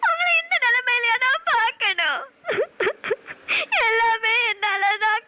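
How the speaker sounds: tearful, breaking cry of despair; sobbing lament. Acted. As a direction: weep over him, voice cracking. Roja sees Raja imprisoned, weeps.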